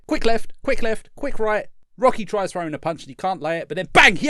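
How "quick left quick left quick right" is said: mock commentary. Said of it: "Just like".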